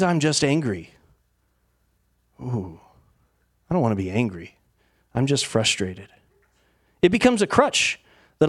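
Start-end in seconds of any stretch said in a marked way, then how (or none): 2.4-6.1 low, subdued self-excusing aside; acted reluctant excuse. Avoiding admitting anger to oneself, acted subdued reluctance. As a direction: say it low, backing away from the admission.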